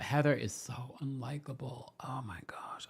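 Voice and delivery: catty whisper to the viewers; whispered aside. Real, not acted. Host confiding to viewers about Heather, whispered. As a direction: lean in and whisper the gossip.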